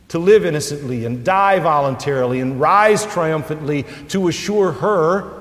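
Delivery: lifting the congregation toward comfort, preaching hard; fervent preaching. Acted. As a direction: preach it out with rising force. Preaching at full force.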